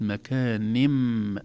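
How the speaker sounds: مكانم slow, ending on a clear m; tajweed iqlab demo. Shown as a demonstration. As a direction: say مكانم with the tanwin turned into m. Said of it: "انقلبت".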